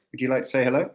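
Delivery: usual speaking voice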